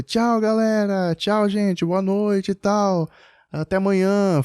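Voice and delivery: put-on high, slow little-kid goodbye; imitating son. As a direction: sing out a cheerful child's bye-bye. Son waving bye to men.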